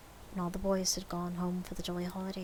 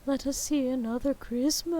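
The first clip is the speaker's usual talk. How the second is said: ghost voice; 6 semitones higher, 4 dB louder than usual. A narrator's reading. Spirit voiced.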